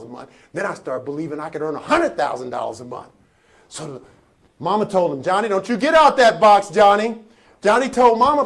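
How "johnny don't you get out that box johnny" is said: put-on raised voice, scolding a child; mama's stern order. Mama warns Johnny to stay in the box, loud scolding. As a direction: order the boy loudly, as a retold mother.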